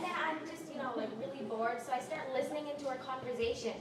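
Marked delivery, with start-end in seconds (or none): none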